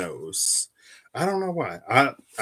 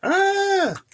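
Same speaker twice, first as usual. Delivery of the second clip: sudden high cry as the cards slip; startled yelp; 5 dB louder than usual. Real, not acted. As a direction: yelp at a fumbled shuffle. I completely fumble that shuffle.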